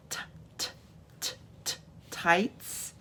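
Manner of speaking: voiceless little "t" taps before "tights"; beginning sound isolated. Shown as a demonstration. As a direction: tap out quiet T sounds, then the word "tights". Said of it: "we're not listening to the beginning".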